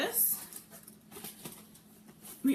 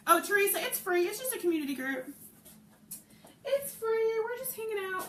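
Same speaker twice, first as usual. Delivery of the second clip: upbeat coaxing; 10 semitones higher, 4 dB louder than usual. Real, not acted. Reassuring a viewer in live chat.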